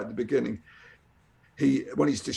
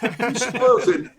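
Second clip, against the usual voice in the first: laughing and higher, complaining; laughing mock complaint; 8 semitones higher, 4 dB louder than usual. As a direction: laugh through a fake complaint. Friend jokingly upset at spoiler.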